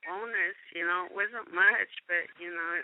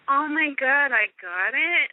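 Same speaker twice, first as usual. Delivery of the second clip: higher-pitched, thrilled retold reaction; happy disbelief; 7 semitones higher than usual. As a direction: relive the joy of landing the part. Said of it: "I was all happy".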